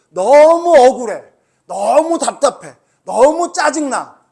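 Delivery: hammed-up fed-up outburst; exasperated. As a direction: stretch each 너무 in mounting exasperation. Acting out frustration at unfair events.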